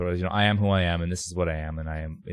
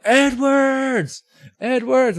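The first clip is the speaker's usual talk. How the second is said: high, loud drawn-out shout of a name; mock stadium yell; 3 dB louder, much slower than usual. Dudes in the stands yelling at the player. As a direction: call out across the field like a heckler. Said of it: "they keep going".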